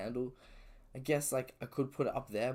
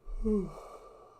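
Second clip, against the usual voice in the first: long high yawn breaking into a sigh; 12 semitones higher, 5 dB louder than usual. Real, not acted. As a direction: let out a tired yawning sigh. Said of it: "I was trying not to yawn so bad".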